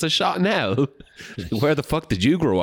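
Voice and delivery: high, laughing disbelief; shocked disbelief. Retold or imitated. Graham Norton shocked at the actress's phrase, high laughing disbelief. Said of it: "And he, he goes".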